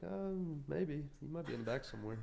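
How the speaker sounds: mock uncertainty, as if playing dumb; playfully coy. Owner coyly answers customer asking for himself.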